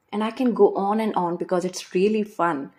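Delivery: ordinary talk, nothing marked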